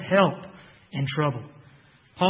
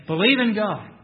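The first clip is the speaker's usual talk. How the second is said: "Believe" said as an order, a bit higher and louder; imperative; 4 semitones higher, 4 dB louder than usual. Shown as a demonstration. Preacher reading the ESV wording to his congregation as a command, higher and louder. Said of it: "translates both believes as imperative".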